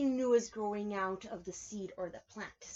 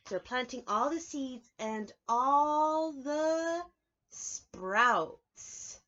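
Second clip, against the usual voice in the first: storybook reading; 5 semitones higher, 6 dB louder, much slower than usual. A narrator's reading. Reading picture book aloud.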